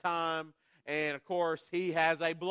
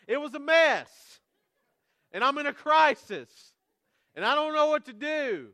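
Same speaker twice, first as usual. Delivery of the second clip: high, loud comic alarm; mock panic; 12 semitones higher, 7 dB louder than usual. Dad telling the church about the diaper disaster, hammed-up panic. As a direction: play up the panic of the mess.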